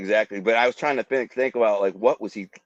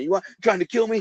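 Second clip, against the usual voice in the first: high, furious yell while fighting; retold yelling; 9 semitones higher, faster than usual. Man choking attacker, yelling at him in fury. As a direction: snarl it mid-fight, higher pitch. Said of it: "I kept saying".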